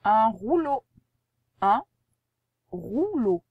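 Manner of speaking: loud, clear dictation word; dictation reading. Shown as a demonstration. Teacher dictating rouleau to the pupil, loud and clear.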